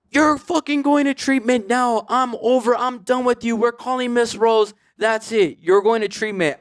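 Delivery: an aunt at the end of her rope, laying down the law; furious aunt. Retold or imitated. Aunt ordering her addicted nephew into treatment, furious. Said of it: "she's like".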